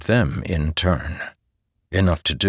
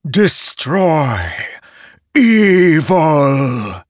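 high, loud, drawn-out scream; a cursed blade screaming; screaming sword; 9 semitones higher, 6 dB louder, much slower than usual. A narrator's reading. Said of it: "screaming in a voice that lacked".

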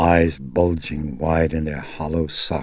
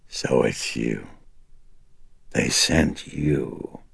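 lower, slow and menacing demon voice; deep menacing demon; 2 semitones lower, slower than usual. Acted. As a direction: threaten him slowly from deep down. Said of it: "a voice that was deep and thick with menace".

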